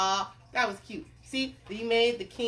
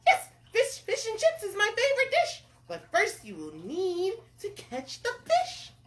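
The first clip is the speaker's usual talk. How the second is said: high-pitched excited little character; eager cook; 12 semitones higher, 4 dB louder than usual. Acted. Cook answers the king about his dish, eager high voice. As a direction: pipe up keenly, high and lively.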